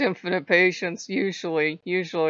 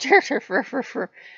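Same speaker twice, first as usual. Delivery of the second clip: louder, high imitated fussing in nonsense syllables; mock grumbling gibberish; 6 semitones higher, 4 dB louder than usual. God complaining about junk in his way, mock grumbling. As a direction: sputter wordless complaints.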